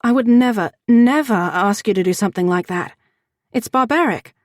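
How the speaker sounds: loud, high cry of horror; horrified outburst. A narrator's reading. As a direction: recoil in horror and protest. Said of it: "Ren gasped in horror".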